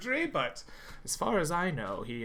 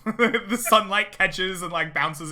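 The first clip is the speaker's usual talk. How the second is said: laughing; 6 semitones higher, 10 dB louder than usual. Real, not acted. DM laughing mid-description.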